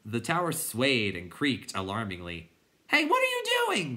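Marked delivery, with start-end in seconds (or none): 2.9-4.0 very high, loud cry; angry, frightened shout. A narrator's reading. Bastian shouting at the tower wreckers, high alarmed shout. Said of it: "Bastian shouted".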